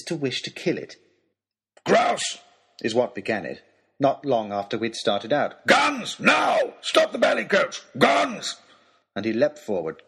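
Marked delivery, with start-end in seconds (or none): 1.9-2.4 sudden loud cry; excited hunter's shout. Acted. Baronet spots game and yells. 5.7-8.6 high, loud hunter's yell; frantic shouting for guns; urgent shouted command. Acted. Baronet demands his guns.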